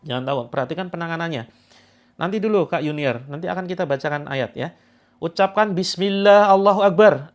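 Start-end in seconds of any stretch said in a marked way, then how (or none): 5.2-7.3 loud invocation. Shown as a demonstration. Healer leading patients in a loud takbir. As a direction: call it out to lead the room. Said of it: "Agak keras, teman-teman".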